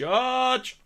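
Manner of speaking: high-pitched call across the room; calling a judge. Retold or imitated. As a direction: mimic a player summoning the judge. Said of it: "somebody about twenty foot away just going".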